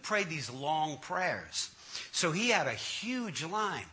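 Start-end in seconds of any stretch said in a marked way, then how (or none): none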